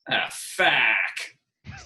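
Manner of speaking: drawn-out Australian "Ah, fack"; put-on Australian swearing; Australian accent. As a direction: imitate an Aussie mate swearing, vowel stretched. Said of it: "I know how to say fuck in Australian".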